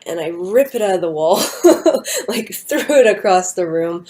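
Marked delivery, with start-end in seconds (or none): 1.5-2.2 sudden high, loud laugh; burst of laughter. Real, not acted. Telling how she ripped out the strobe light, loud high laugh. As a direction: laugh out at the absurd memory.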